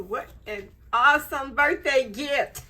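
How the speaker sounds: real happy laugh in the voice; laughing joy. Real, not acted. Delighted by gift.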